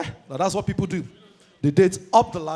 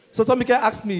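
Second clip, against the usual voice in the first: ordinary delivery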